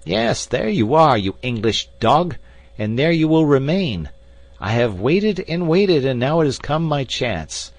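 acted villain's tirade, easing slightly as it goes; frantic gloating. A narrator's reading. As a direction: crow over trapped enemies across a chasm. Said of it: "more frantic than before".

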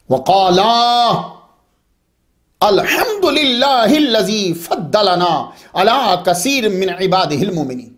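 slow, drawn-out verse recitation; Quran recitation. Real, not acted. Preacher reciting a Surah Naml verse to listeners, Quran recitation. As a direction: recite the verse with long held vowels. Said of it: "सूरतुन नमल की आयत number पन्द्रह से सुने".

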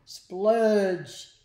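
loud, drawn-out splat word; big comic-book sound effect; exclaimed sound effect. Acted. Sound word as slime lands.

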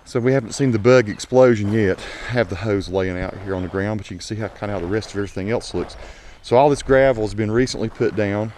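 normal talking voice

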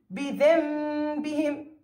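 بذنبهم with the ن turned into a long hummed m; slow demonstration of بذنبهم, holding the m sound; iqlab pronunciation. Shown as a demonstration. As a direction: draw out a nasal m before the ب in بذنبهم. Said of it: "كيف ألفظها؟".

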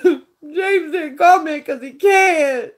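half laughing, half crying, voice cracking high; cry-laugh the words out; laughing through tears. Real, not acted. Telling James how she missed him, laughing and crying. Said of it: "It broke my heart".

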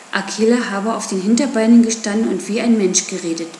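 plain reading voice

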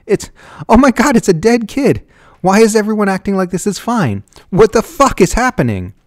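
high, loud, panicked outburst; hysterical, shouting for an answer; frantic panic. A narrator's reading. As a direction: cry out in horrified disbelief. Susan panics at the dead child.

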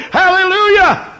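usual speaking voice

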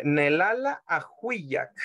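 Nelala ajuiak said slowly and carefully; slow model phrase. Shown as a demonstration. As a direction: spell it out for the learners. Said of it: "usted dice".

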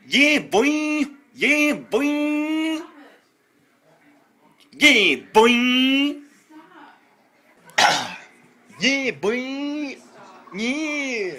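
high, drawn-out goofy cry; goofy high-pitched whoop; high silly squeal. Pretend. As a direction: squeal it and hold the last sound. Performing noisy catchphrase at mom.

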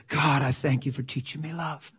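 ordinary talk

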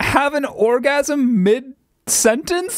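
high-pitched, laughing disbelief; incredulous. Real, not acted. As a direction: demand an explanation, pitch soaring, key words stretched. Stunned by the bizarre voice glitch.

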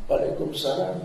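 put-on soft, frail reply of an elderly mother; old mother's voice. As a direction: answer quietly like an aging mom. Mother answers child in sermon story. (suara ibu tua yang pelan)

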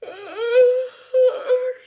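big high loud shocked gasp. Acted. Elephant waking with a loud high gasp. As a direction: gasp in alarm.

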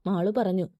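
ordinary delivery, nothing marked

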